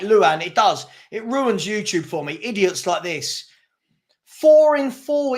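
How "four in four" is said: exasperated. Real, not acted. Exasperated retort to chat.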